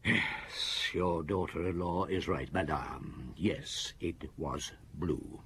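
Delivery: low, soft, slow, measured words; quiet grim confirmation. Acted. Inspector quietly confirms the mother's lie to her. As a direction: let the truth land softly.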